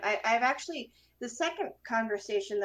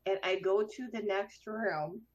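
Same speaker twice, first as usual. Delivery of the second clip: ordinary delivery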